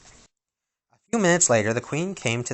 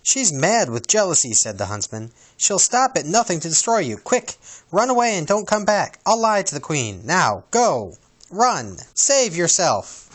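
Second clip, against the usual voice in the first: high, loud urgent plea; urgent warning; 7 semitones higher, 3 dB louder than usual. Acted. Huntsman urgently begs the princess to flee. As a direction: push her to run.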